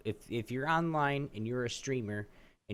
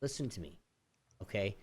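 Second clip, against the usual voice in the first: much softer, stern and measured; stern quiet; 4 semitones lower, 7 dB quieter than usual. Retold or imitated. Telling the phone rep to listen, soft and stern.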